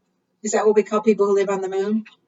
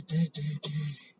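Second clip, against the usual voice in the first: bee buzz; 5 semitones lower, 7 dB quieter than usual. Pretend. Imitating bees at bee homes.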